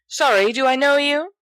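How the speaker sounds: breezy, high and offhand; airy. Acted. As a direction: brush her off lightly. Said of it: "Sirius says airily".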